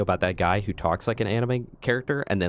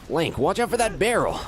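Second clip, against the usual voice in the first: high voice; 9 semitones higher than usual. Acted. Calling out game voice lines.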